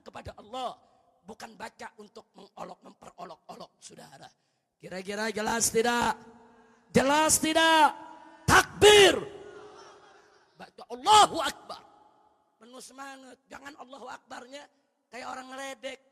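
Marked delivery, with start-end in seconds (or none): 4.8-11.8 rousing call-out. Acted. Preacher calling the congregation to shout takbir, rousing. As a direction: rouse the congregation to answer.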